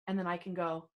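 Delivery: usual speaking voice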